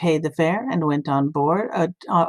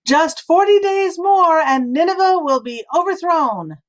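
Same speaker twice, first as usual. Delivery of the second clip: cried-out warning; 9 semitones higher, 5 dB louder than usual. A narrator's reading. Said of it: "he cried out".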